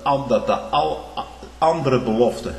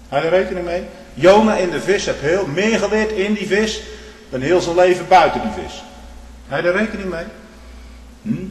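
urgent appeal; 7 semitones higher, 6 dB louder than usual. Real, not acted. Preacher presses the congregation.

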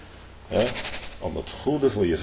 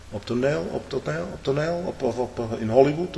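mocking questions; 7 semitones higher than usual.

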